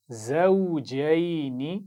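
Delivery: drawn-out, melodic tajweed recitation; slow Quran recitation. Shown as a demonstration. Teacher reciting slowly for pupils to repeat. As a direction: chant each syllable slowly for the class to copy. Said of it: "गौर से सुनिए और दोहराइए".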